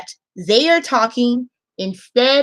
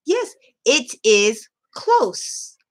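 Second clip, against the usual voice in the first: very slow, careful model answer; slow teaching voice; 3 semitones higher, much slower than usual. Shown as a demonstration. Teacher modelling an answer for kids, very slow. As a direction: spell out each word for learners. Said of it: "you can teach them that".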